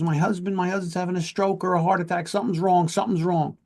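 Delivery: imitating the panicked wife begging for help; hysterical wife. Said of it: "she's, she's hysterical".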